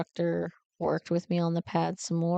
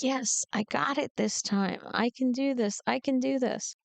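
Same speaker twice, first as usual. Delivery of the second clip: self pep talk; 6 semitones higher than usual. Retold or imitated. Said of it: "And I was like".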